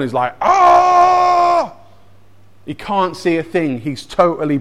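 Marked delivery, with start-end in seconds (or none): 0.4-1.7 long, high, loud cry of pain; sustained hammed-up "aah" of shock; acted scream. Saul blinded on the floor by the light, acted screaming. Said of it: "roll around on the floor and scream".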